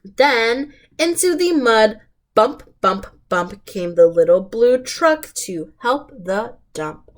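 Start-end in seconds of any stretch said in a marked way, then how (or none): none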